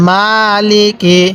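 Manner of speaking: slow "মালিকি" with the first vowel drawn out; one-count madd. Shown as a demonstration. Teacher shows students how to read it, slow "মালিকি" with long "মা". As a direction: stretch "মা" in "মালিকি", no stretch on the last syllable. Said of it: "মায়ের মধ্যে এক আলিফ টান দিব".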